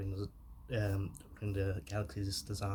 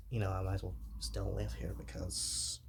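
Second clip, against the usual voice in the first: ordinary talk, nothing marked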